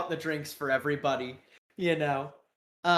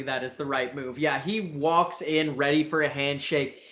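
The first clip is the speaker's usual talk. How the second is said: normal talking voice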